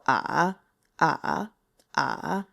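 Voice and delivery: "ah" dropped low into vocal fry, lifting slightly; low dipping third tone. Shown as a demonstration. Teacher demonstrating Mandarin third tone, low fried dipping "ah". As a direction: sink "ah" to the lowest point, then raise a little. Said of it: "speak at the lowest point".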